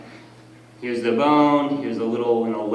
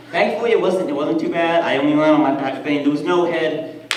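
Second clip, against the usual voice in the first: normal talking voice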